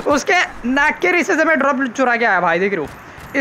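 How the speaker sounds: ordinary talk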